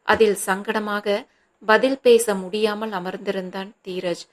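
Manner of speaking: ordinary delivery, nothing marked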